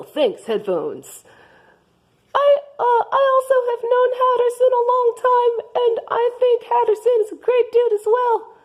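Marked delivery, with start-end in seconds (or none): 2.3-8.6 high Martha voice. Acted. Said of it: "I am Martha".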